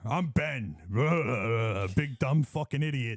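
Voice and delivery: dopey tough guy voice; mocking Ben. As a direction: mimic him as a dumb tough guy. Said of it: "talking like me now".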